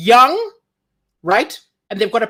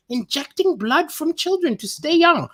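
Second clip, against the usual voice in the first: put-on higher, disbelieving repeat of "Injecting blood from children to stay young"; incredulous repeat; 5 semitones higher than usual. Shown as a demonstration. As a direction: say it back as if you can't believe it. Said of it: "I've never heard of this".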